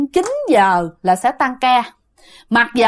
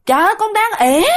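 high, fast, fed-up call back; exasperated reply; 9 semitones higher, faster than usual. Retold or imitated. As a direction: snap back at a dad who won't stop calling. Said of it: "cái bạn mới". (con gái bực bội gọi vọng lại, giọng cao và nhanh)

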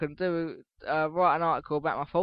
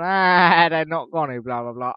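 mock dismissive friend; 7 dB louder than usual. Retelling friend's answer about a PlayStation release, mock dismissive. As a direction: imitate a friend brushing it off. Imitates friend brushing off his wish.

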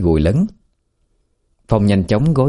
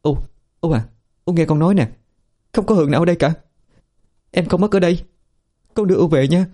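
soothing daughter; 10 semitones higher, faster than usual. A narrator's reading. Daughter soothing confused mother.

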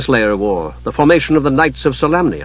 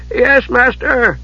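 high, slow, nervous answer; startled servant; 11 semitones higher, much slower than usual. A narrator's reading. Monk jumping at his master's call, startled. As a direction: answer high and drawn out, caught out.

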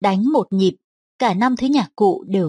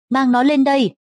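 calling out an order; 6 semitones higher than usual. Acted. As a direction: call the order across the hall. Lord orders prisoner brought up.

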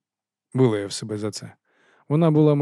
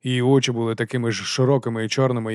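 ordinary delivery, nothing marked

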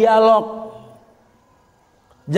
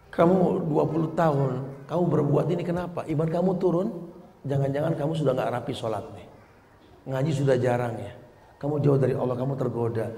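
calm discussion; 7 semitones lower, 8 dB quieter than usual. Acted.

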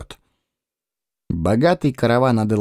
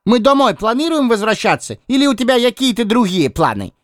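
cheeky Lis voice; 10 semitones higher than usual. Acted. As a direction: needle the captain in a high voice.

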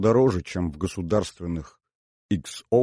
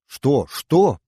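high, louder questions, rising in pitch; excited and insistent; startled eagerness; 6 semitones higher, 5 dB louder than usual. Acted. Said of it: "подскочила Маша".

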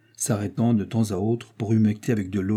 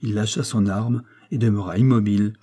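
ordinary delivery

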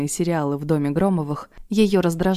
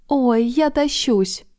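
slow, high, drawn-out gush; gushing exclamation; 6 semitones higher, much slower than usual. A narrator's reading. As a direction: gush like a giddy girl outside. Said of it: "воскликнула какая-то барышня".

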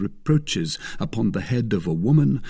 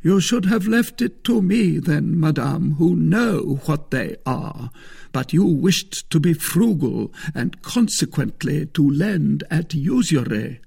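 higher-pitched, lofty scolding; reproachful Musketeer; 7 semitones higher, 3 dB louder than usual. A narrator's reading. Porthos reproaches the frugal wife, lofty. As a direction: scold with injured dignity.

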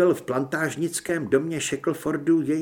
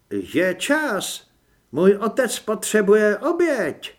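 put-on young woman's voice; 6 semitones higher, 6 dB louder, slower than usual. Mladá žena přichází.

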